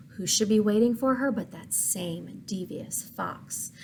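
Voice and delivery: ordinary delivery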